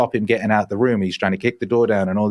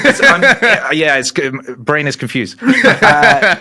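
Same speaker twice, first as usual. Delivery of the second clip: laughing at himself; embarrassed laughter at a mixed-up word; 5 semitones higher, 7 dB louder, slower than usual. Real, not acted. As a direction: giggle through the words. Laughing at his own slip.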